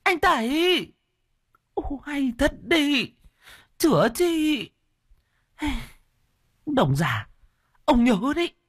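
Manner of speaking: high, indignant scolding; angry wife. A narrator's reading. Wife scolds her husband and Đồng for leaving, high and indignant. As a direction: stamp and scold, high-pitched. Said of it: "Mợ tức giận dậm chân".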